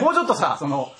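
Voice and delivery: ordinary talk, nothing marked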